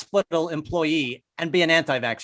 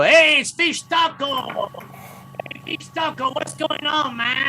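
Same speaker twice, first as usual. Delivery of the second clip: put-on high, drawn-out stoner Mexican accent; hammed-up Mexican stoner accent, high-pitched; mock Cheech-and-Chong accent; 10 semitones higher, slower than usual. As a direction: greet a regular in a put-on Chicano drawl. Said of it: "don't know why they talk like that".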